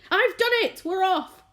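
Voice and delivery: very high, loud cry of triumph; triumphant shout. A narrator's reading. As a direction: crow it from the hole above. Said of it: "and shouted".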